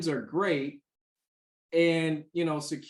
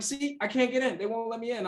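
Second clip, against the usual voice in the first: higher-pitched mock complaint; lightly voiced, higher fretful lady; mimicking the frustrated client; 6 semitones higher, faster than usual. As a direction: play the anxious client, pitched up.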